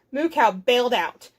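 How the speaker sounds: ordinary delivery, nothing marked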